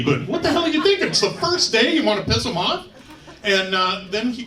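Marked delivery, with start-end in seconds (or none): 0.0-2.9 high, quick scolding rush; exasperated. Acted. Recruit silently scolding whoever won't answer roll call, exasperated. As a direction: berate the culprit in comic disbelief.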